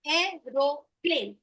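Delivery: aeroplane broken slowly into syllables; slow syllabified. Shown as a demonstration. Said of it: "When I say aeroplane".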